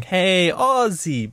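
high, very slow drawn-out call of hey Aussie; retelling an ice cream ad, mimicking the Bali vendor's slow, high greeting. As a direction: stretch it out like a beach hawker calling to tourists. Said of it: "everyone would walk around going".

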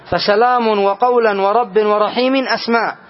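ordinary talk

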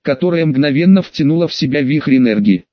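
ordinary delivery, nothing marked

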